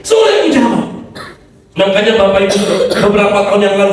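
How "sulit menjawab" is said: amused admission. Real, not acted. Laughing admission after laugh.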